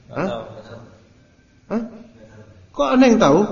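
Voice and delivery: high, loud mock disbelief; incredulous. Ustadz mocking the artist's claim, incredulous. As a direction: throw it back in disbelief.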